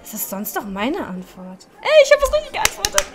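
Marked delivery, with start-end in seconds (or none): 1.8-3.0 high, loud gleeful shriek; triumphant squeal. Real, not acted. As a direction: crow about getting it right. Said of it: "ich wollte dich ein bisschen ärgern".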